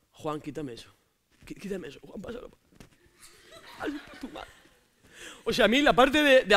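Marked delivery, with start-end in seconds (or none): 0.1-4.5 put-on hushed, teary girlfriend at the series; whisper-begging Juan to switch it off; girl watching series. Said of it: "más de una así como". (novia conmovida susurrando a Juan que quite la serie)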